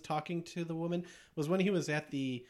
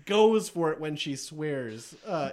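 usual speaking voice

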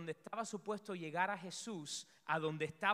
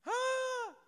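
frightened scream; 5 dB louder than usual. Acted. Disciples see a ghost.

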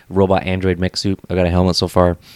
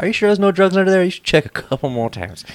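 flirty mock plea; 12 semitones higher, 4 dB louder than usual. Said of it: "I'm like".

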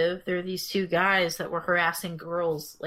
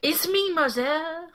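mock French accent; 10 semitones higher than usual. Mock French impostor at the door.

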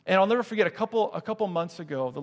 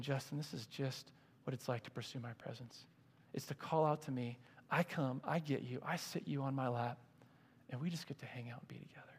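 soft voice of the Lord; 2 semitones lower, 10 dB quieter than usual. Retold or imitated. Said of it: "the Lord gave me this picture saying".

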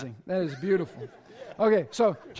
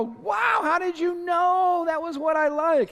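amazed delight; 6 semitones higher than usual. Retold or imitated. Gift receiver thrilled by a thoughtful present, high gushing. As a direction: gush over a gift that fits just right. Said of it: "You open it up and you go".

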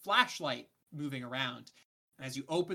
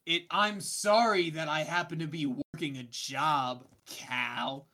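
defensive snap; 2 semitones higher, 7 dB louder than usual. Acted. Lucia snaps back at Cal.

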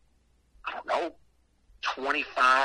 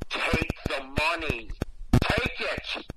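insistent; 2 semitones higher, slower than usual. Retold or imitated. Angry woman pushing a dollar on him, insistent. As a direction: press it on them word by word.

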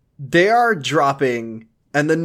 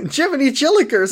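mock cartoon exclamation; 10 semitones higher than usual.

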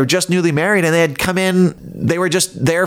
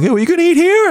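much higher, louder excited fan voice; eager fan; 14 semitones higher, 4 dB louder, faster than usual. Retold or imitated. Bank customer recognizing the host, high eager question. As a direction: chirp it like a starstruck stranger. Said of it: "walking into the bank going".

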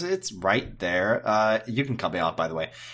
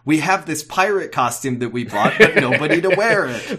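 mock announcer voice; 5 semitones higher, 6 dB louder than usual. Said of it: "maybe the episode will end with".

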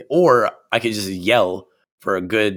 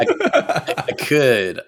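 laughing; 6 semitones higher, 3 dB louder, slower than usual. Real, not acted. Laughs at being teased.

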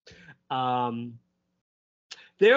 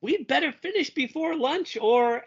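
lightly voiced Burger; 11 semitones higher than usual. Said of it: "and he says".

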